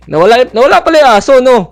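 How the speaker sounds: high, loud cry of surprise; alarmed shout. Real, not acted. Player telling squad the dog vanished, alarmed shout.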